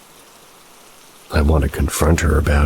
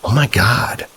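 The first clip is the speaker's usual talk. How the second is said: exclaiming; 8 semitones higher than usual. Acted. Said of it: "she exclaims".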